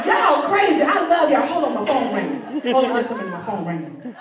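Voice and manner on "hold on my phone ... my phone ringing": low, dropping voice; hushed phone aside. Pretend. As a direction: excuse yourself quietly to take a call. Aside to crowd about ringing phone.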